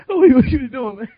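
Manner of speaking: laughing through a high protest; giggling while pleading his case; laughing, playfully protesting. Retold or imitated. Laughing retelling.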